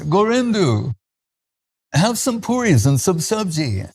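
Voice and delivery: a hint of a kindly swami urging him to eat; Swami Maharaj offering food. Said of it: "Swami Maharaj would say".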